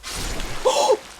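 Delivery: sharp, high intake of breath; astonished gasp. Real, not acted. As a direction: gasp in sudden disbelief. Throwing knife unexpectedly hits.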